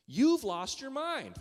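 high, loud and slow hammed-up indignation; mock outrage. A pastor hamming up his road rage at a driver, loud and high. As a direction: yell it slowly at the imagined driver.

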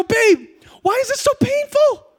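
very high comic whine-shout; mock pained wail. Husband asks wife why waxing hurts, pained wail. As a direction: wail it in exaggerated pain, pitch sky-high.

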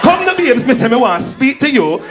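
high, loud, exaggerated lisp on "babes"; overdone lisped s in "speak to you"; forced sexy lisp. Jamaican woman luring visitor, loud lisp on "babes". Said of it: "a forced lisp to make themselves sound sexy".

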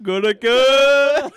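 high, loud and slow, hammed-up; mock moan. Joking with his cohost, hammed-up slow moan. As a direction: drag it out like a cheesy porn line.

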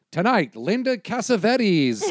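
big drawn-out show billing; club announcer. Pretend. Host advertising a comic under a fake name, drawn-out announcer. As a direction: stretch it out like a marquee announcement. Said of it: "advertise you if you're like".

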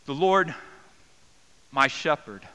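loud, ringing cry of the psalm's opening; calling out. Shown as a demonstration. Preacher to congregation, loud crying out of the psalm's opening. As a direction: call it out to God, full voice.

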